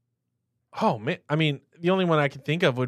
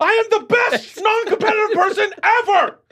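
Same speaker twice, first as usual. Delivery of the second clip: much higher, louder laughing brag; mock boasting shout; 4 dB louder than usual. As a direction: shout a silly brag back.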